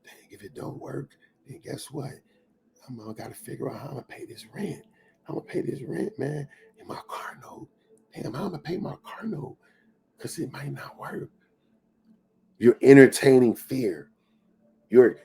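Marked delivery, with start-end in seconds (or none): 0.0-11.3 very soft, half-whispered self-doubt; hushed inner worry. Acted. Speaker voicing someone's private money worries, hushed and fretful. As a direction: mutter anxious thoughts under your breath.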